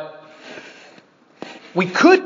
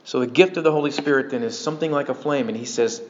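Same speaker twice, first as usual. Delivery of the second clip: ordinary delivery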